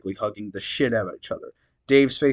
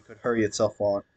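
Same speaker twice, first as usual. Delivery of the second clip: ordinary delivery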